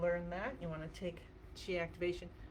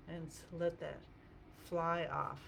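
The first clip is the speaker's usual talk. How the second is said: normal talking voice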